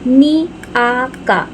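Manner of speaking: نی آ کا slow, each syllable the same length; even short syllables. Shown as a demonstration. Teacher models even syllables for students. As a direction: keep نی آ کا evenly timed. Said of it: "تینوں پہ حرکت ہے".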